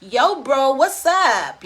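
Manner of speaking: loud, high-pitched American greeting; big, punchy American accent. Shown as a demonstration. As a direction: ham up an American "what's up" voice. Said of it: "Watch my American".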